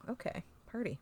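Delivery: soft whisper; whispered aside. Real, not acted. To friends, a whispered little cheer. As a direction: breathe it out as an aside.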